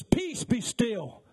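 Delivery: high, slow, ringing command; commanding calm. Acted. Jesus at the bow commanding the wind, slow and ringing. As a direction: still the storm with one order.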